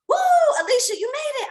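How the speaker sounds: loud, high whoop of celebration; cheering. Retold or imitated. Everybody celebrating Alicia making it across, high cheering. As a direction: whoop like a crowd cheering her on. Crowd cheers her crossing.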